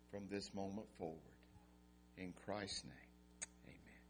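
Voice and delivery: soft, slowing prayer voice. Acted. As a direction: pray quietly, fading at amen. Pastor leads prayer.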